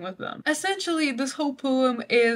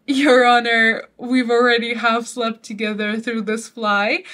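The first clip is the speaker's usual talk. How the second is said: mock courtroom plea; 7 dB louder than usual.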